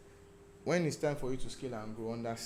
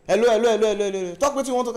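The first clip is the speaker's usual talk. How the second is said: bellowed hello, much higher and louder; loud phone greeting; 8 semitones higher, 11 dB louder, much faster than usual. Retold or imitated. Phone trader shouting at a caller, loud impatient greeting. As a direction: yell it down the phone like a busy trader.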